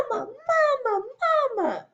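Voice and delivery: high, loud shout of Mama. Acted. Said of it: "starts to shout".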